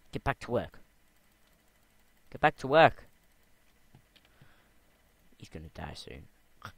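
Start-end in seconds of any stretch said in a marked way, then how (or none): 0.1-0.8 loud call, firm not yet yelling. Real, not acted. Calling out to the lazy villager, firmly raised voice. 2.3-3.0 much louder and higher all-out yell; full yell. Real, not acted. Yelling at the lazy villager to get back to work.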